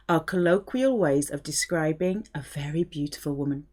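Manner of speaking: ordinary talk, nothing marked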